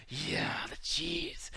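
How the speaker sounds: slow, breathy hungry whisper; mock hungry whisper. Craving his Doritos, a slow mock-hungry whisper. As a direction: whisper it slowly like a starving demon. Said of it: "I'm just yelling, like".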